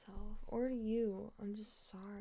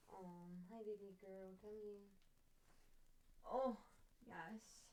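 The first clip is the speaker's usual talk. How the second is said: softer, tender coo to the kitten; soft pet greeting; 11 dB quieter than usual. Real, not acted. Greeting cat softly.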